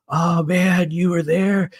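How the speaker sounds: put-on light up like a stoned old surfer remembering; excited hippie. Narrator imitating the coworker suddenly remembering, high excited hippie voice. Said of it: "then he said something like".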